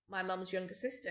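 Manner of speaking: usual speaking voice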